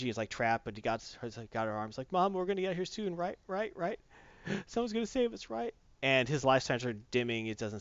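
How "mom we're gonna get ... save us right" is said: put-on high, soft, anxious child voice; acted small boy, gasping and pitched up; little boy. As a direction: plead with mom as a frightened little boy. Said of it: "there's this little boy".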